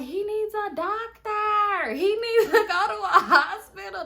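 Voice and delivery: high, loud, drawn-out plea; mock hysterical wail. Drunk girl demanding a doctor, loud high mock wailing. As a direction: wail it slowly, hammed-up desperation.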